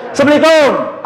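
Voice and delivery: high, loud greeting at the door; husband calling greeting. Acted. Husband arriving home greeting his wife, loud call. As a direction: call out from the doorway.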